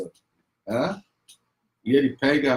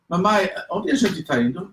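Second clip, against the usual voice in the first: put-on curious child; 6 semitones higher than usual. Child asks mother in car.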